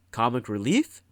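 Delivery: usual speaking voice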